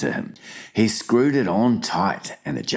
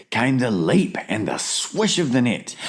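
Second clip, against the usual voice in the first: plain reading voice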